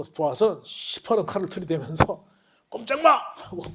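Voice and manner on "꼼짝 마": robber's threat. Acted.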